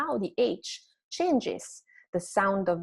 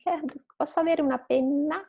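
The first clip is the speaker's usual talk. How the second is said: higher, quick Italian request; asking a classmate; 7 semitones higher, faster than usual. Acted. Student asking a classmate for a pen, higher quick question. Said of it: "If you ask for a pen to your classmate".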